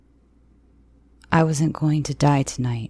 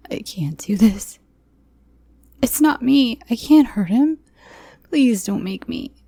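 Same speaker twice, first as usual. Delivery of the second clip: high, louder, desperate begging; terrified refusal to the masked man; frightened pleading; 8 semitones higher, 4 dB louder than usual. Acted. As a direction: plead for him not to force you.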